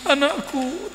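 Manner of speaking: high, soft mock wail; a hammed-up mother crying for her child; mock weeping mother. A mother weeping as her son runs off, mock wail. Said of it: "nangis-nangis".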